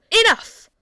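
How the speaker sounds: a king's sudden roar, cut off hard; furious shout. A narrator's reading. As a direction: bellow it to silence her. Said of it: "the king shouted at her".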